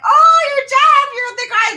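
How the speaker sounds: high, loud starstruck outburst; excited recognition. Retold or imitated. Retelling meeting Jeff at the radio station, excited recognition. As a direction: blurt it out in over-the-top delight.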